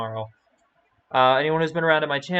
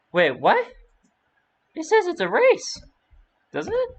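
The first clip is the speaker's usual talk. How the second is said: surprised; 4 dB louder than usual. Real, not acted. Confused by chat message.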